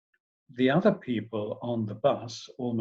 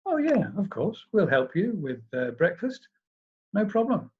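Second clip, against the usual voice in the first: forced nonchalance; 7 semitones higher than usual. A narrator's reading. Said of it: "John tried to be nonchalant".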